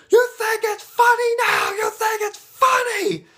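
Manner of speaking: very high, strained angry shouting; furious mock yell. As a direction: scream it in Brutus's face-to-face rage, drawn out at the end. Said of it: "his face just yells".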